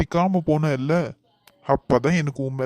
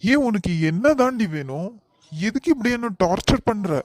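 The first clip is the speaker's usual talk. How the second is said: exasperated; 6 semitones higher than usual. Acted. Exasperated at her clinging.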